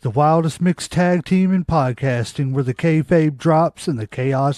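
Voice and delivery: loud, punchy show intro; announcer voice. Acted. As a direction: hype up the show open. Podcast show opening.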